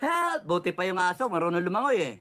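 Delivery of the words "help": high, hammed-up yelp for help; a quick pretend plea; mock cry for help. Voicing flooded residents, a playful high cry for help. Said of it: "sabi ng mga tao".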